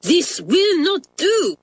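put-on bang the desk in outrage, French accent; Mamselle crying out. French mistress furious at the twins' essays, shouting. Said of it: "cried Mamselle".

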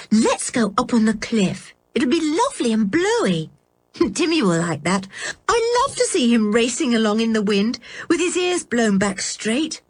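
a hint of a happy young girl with a small laugh; cheerful Anne. Anne suggests a walk.

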